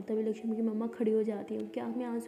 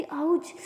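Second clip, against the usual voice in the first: yelp of pain; 5 semitones higher, 5 dB louder, much slower than usual. Acted. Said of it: "Lakshmi कहती".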